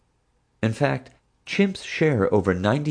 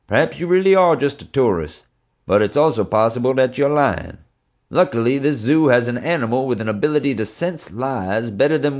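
higher, louder staged speech; rehearsed cop speech; 7 semitones higher, 3 dB louder than usual. A narrator's reading. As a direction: play it up for the suspect. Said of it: "launched into the speech we'd all prepared".

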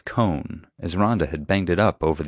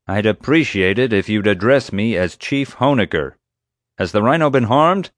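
loud, raised-pitch demand over the radio; officious security chief; 4 semitones higher, 5 dB louder than usual. A narrator's reading. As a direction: insist on your rank, forcefully.

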